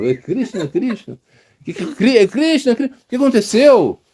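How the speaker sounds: eager and a bit alarmed; excited devotees. Retold or imitated. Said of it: "Aí os devotos foram". (devotos agitados, voz mais aguda)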